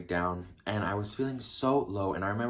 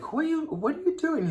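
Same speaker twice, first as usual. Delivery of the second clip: high falsetto questioning; prying question; 3 dB louder than usual. Retold or imitated. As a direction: imitate a squeaky, prying question. Questioning him about constant phone use.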